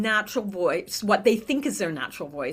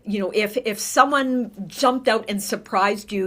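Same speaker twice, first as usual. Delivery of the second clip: ordinary talk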